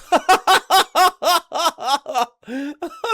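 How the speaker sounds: loud, high burst of laughter. Real, not acted. Laughing loudly at the Satanic Bible's psychic vampires, real laughter. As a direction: crack up out loud at the phrase.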